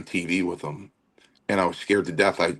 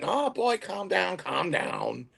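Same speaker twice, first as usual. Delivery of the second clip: high hushing voice, dropping softer; mock soothing, pitched up and easing down; imitated opponent; 10 semitones higher, 5 dB quieter, slower than usual. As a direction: imitate a wrestler calming a kid, high then settling. Acting out the opponent hushing him.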